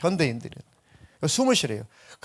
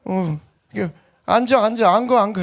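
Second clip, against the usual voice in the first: barber urging to sit; 6 semitones higher, 4 dB louder than usual. Retold or imitated. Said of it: "가지고".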